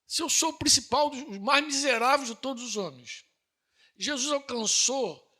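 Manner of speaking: rising pulpit fervor; fervent preaching. Acted. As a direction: build the fire of the sermon. Preacher building to climax.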